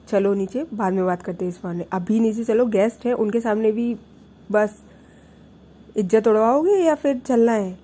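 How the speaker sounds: loud, stern command; stern order. Retold or imitated. Mother loudly orders Prachi downstairs in front of guests. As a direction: order her downstairs, no argument.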